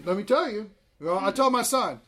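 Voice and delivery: normal talking voice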